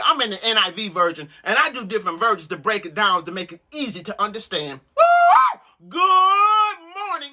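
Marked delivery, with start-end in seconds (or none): 5.0-7.3 high, loud, slow whooped good morning; ecstatic greeting. Real, not acted. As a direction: sing out the greeting in joy.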